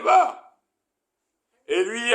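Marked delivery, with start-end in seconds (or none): 0.0-0.6 emphatic command. Shown as a demonstration. Exclaiming God's command.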